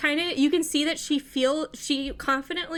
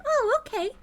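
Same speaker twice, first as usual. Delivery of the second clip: much higher, sniffing and curious; high curious little cat; high gentle kitty pet; 9 semitones higher than usual. Acted. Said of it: "she's like".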